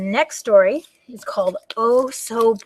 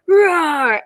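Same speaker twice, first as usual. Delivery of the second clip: long rolled play roar; drawn-out storybook dragon bellow; 7 semitones higher, 4 dB louder than usual. Pretend. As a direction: roar like a valiant little dragon. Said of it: "so we can all roar".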